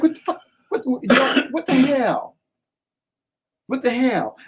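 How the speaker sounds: high, incredulous what-the-hell; shocked disbelief. Retold or imitated. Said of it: "I'm like".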